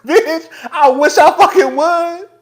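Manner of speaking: high, loud defiant sass; sassy high mock threat. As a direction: dare them to try it. Defiant outburst.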